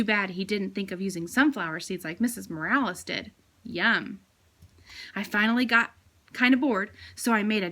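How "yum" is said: plain reading voice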